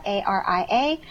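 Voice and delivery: ordinary talk, nothing marked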